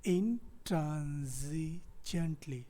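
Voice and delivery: "intransigently" drawn out very slowly, syllable by syllable; word pronunciation demo. Shown as a demonstration. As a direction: model "intransigently" carefully, stretching each of its syllables. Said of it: "This word is pronounced".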